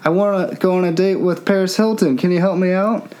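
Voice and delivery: a hint of a whiny buddy begging; mock pleading friend. Mocking friend asking a favor.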